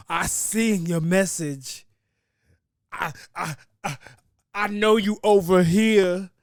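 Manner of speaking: loud, high, drawn-out accusation; mock controlling partner. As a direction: holler it slowly, catching someone out. Mocks a controlling iPhone user.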